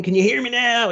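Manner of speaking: high, excited imitation of a woman showing off; mock healed woman. Mocking the supposedly healed woman calling to the crowd, high and excited. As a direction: parody her waving and calling to the crowd. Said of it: "And she's waving".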